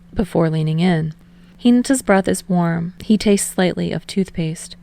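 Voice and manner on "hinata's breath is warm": usual speaking voice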